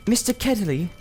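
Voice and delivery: put-on high girlish voice, hushed and careful; a young girl reassuring her friend; Polly, a young girl. A narrator's reading. Polly's voice in the story.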